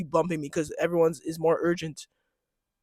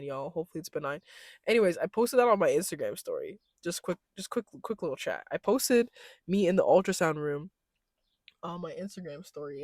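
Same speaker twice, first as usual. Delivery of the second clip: ordinary delivery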